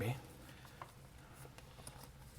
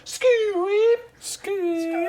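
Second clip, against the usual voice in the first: high squeaky goblin call; rubbery high squeaky toy; 8 dB louder than usual. Pretend. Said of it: "it's a goblin calling contest".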